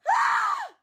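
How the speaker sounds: high, loud, short squeal; celebratory scream. Real, not acted. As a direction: let out a loud shriek of release. Relieved at having finished.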